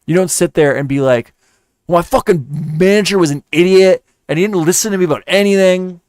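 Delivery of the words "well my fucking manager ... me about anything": higher-pitched indignant imitation of a complainer; exaggerated aggrieved job-seeker rant; mock ranting. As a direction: rant like a self-declared genius. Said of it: "listening to him rant and carry on".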